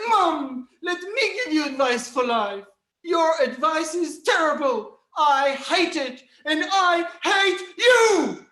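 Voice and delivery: put-on loud, high angry teen, slowing on hate; teenage anger. Teen to mother, loud angry outburst. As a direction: spit it at her. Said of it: "with teenage anger".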